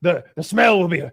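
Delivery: high, theatrical English gusto; hammed-up proud announcement; relishing Englishman. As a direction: revel in how awful it will be. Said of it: "He's like".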